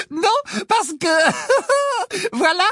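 high voice broken by giggles; high giggly schoolgirl voice; giggling Pansy. A narrator's reading. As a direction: giggle through the words. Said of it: "interrompue par ses gloussements".

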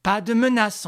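loud, high defiant retort; sharp retort. Acted. Woman refuses to be threatened, loud. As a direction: snap back loudly at the threat.

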